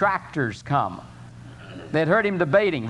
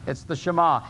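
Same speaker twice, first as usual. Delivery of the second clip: ordinary delivery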